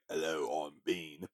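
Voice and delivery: imitating the mime greeting himself, low and hushed; Mister Bean voice. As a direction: mumble it like the silent comic clown. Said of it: "he would say his name is Mister Bean".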